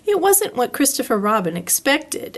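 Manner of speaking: usual speaking voice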